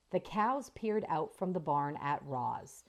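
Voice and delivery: plain reading voice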